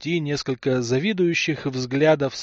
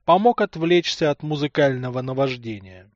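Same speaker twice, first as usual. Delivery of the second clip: plain reading voice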